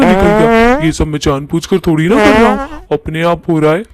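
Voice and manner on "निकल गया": higher, louder startled cry; startled yelp. Acted. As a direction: blurt it out as it escapes. Ghost startled by another fart.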